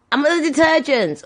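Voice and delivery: high, light call across the aisles; imitated calling back. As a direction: call out where you are. Calling location to mum.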